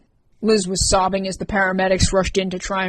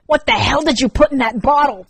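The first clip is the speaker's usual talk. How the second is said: hissed; 6 semitones higher, 6 dB louder than usual. Acted. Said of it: "Rourke hissed".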